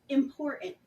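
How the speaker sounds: usual speaking voice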